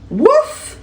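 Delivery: high, yippy little puppy bark; squeaky cartoon puppy woof. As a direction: bark like a tiny playful puppy. Puppy barking in story.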